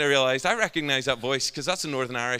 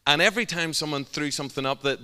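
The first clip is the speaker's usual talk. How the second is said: ordinary delivery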